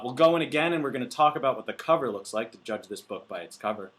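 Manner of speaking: ordinary delivery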